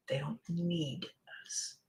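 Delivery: soft whispered ending. Real, not acted. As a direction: let it fall to a whisper. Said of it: "hushed emphasis".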